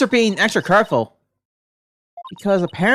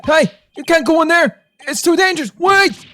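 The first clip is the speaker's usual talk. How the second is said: higher, louder startled policeman; flustered cop calling out, pitched up; high excited officer; 8 semitones higher, 3 dB louder than usual. Acted. Officer stopping a detective entering, raised high and loud.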